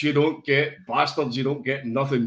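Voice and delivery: normal talking voice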